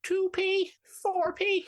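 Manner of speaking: put-on very high, slow old lady counting coins; old woman counting pennies. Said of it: "counting the pennies thing".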